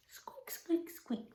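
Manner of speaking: soft, high, tiny squeaks; mouse squeak. Pretend. Teacher cueing children in the fable, small squeaks. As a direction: squeak softly like a little mouse. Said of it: "best mouse with that squeak".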